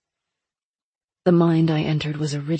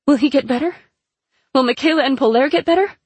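desperate plea; 8 semitones higher, 6 dB louder, faster than usual. A narrator's reading. Tia pleading with the oracle.

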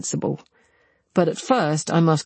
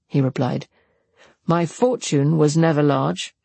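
plain reading voice

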